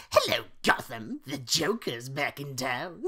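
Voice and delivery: loud manic Joker voice; loud cackling Joker; Joker impression. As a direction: boom it out like a gleeful villain. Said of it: "have you guys heard my Joker impression".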